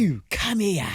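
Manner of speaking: a failed East End impression; bad London accent. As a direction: force out a London accent badly. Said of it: "How can you not do a London accent?".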